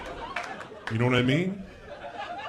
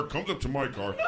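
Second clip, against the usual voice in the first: ordinary delivery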